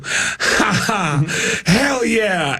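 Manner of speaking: high, pleased mock chuckle and cheer; chuckle, then drawl approval high; Mitch Hedberg. Comedian delighted by a late laugh, high pleased drawl. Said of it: "which made Mitch go".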